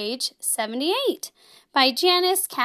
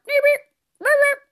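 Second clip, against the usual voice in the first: "ribbit, ribbit" squeaked much higher and louder; high frog ribbit; 11 semitones higher, 4 dB louder, faster than usual. Shown as a demonstration. Host showing kids a squeaky frog ribbit, high and loud. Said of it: "However you ribbit".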